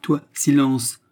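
stern silencing. A narrator's reading. Husband hushing his wife, angry. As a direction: order silence with a stamp. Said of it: "répéta le mari, frappant du pied".

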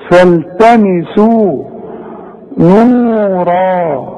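فالتمسوا نورا with the long vowels held two counts, very slow; natural madd. Shown as a demonstration. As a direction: draw out nuura in فالتمسوا نورا. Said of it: "النون برضه مضمومة". (مد طبيعي في نورا)

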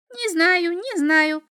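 high, loud imp voice; bouncing little devil. A narrator's reading. Little devil dodges the boy's question, bouncy. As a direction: sing it out high, as if hopping. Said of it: "запрыгал чертёнок".

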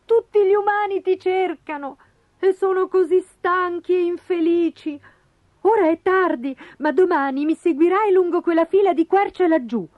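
high little bird voice; helpful wagtail. Acted. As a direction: give directions in a high bird voice. (uccellino premuroso, voce acuta)

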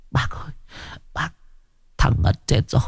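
whispered, breathless; frightened whisper. A narrator's reading. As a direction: hush it like a secret. Tuất confiding in fear. (thì thầm sợ hãi)

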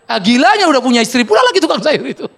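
laughing. Real, not acted. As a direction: laugh out the punchline. Laughing at own joke. (sambil tertawa keras)

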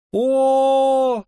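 very high, loud, held cry; sudden high whoop of recognition; cheeky yell. A narrator's reading. Familiar cheeky friend arriving, sudden yell. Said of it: "завопил вдруг знакомый нахальный голос".

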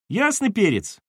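put-on high-pitched boyish retort; cheeky child. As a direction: answer dad with pert confidence. Child answers dad cheekily.

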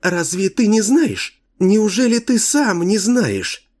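put-on high teasing childlike voice; cartoon princess. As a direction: taunt him like a little girl. The girl teases Kane.

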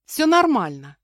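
high, loud smiling reassurance; forced smile. A narrator's reading. Captive woman reassuring the boy, forced cheeriness. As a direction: put on a diligent smile. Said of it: "я старательно улыбнулась Николаю".

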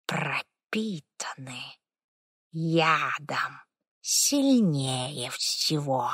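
very slow, hushed, creaky warning; an old spirit warns in a creaking hush. Acted. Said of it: "домовой дух". (тихий скрипучий голос духа)

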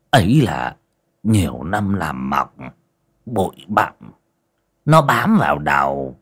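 weak, breathy pleading; feeble whisper. A narrator's reading. Sick old man pleading with exorcist, feeble whisper. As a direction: rasp it out too tired to speak. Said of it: "thều thào".

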